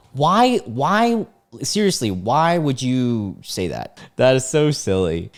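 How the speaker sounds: high-pitched, drawn-out exasperated questioning; slowing at the end, still incredulous; incredulous pleading. Real, not acted. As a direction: plead in baffled disbelief. Baffled reaction to silly reply.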